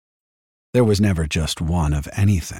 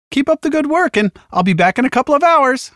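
very high, louder, relentlessly cheerful; chirpy medic; 5 dB louder than usual. A narrator's reading. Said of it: "he'd chirped".

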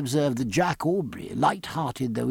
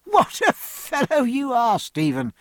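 exclaiming; 10 semitones higher than usual. Acted. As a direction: cry out at a hopeless friend. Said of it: "cried Jack".

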